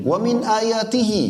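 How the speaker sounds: slow chanted Arabic verse opening; Quranic recitation. Acted. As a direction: intone it like tilawah.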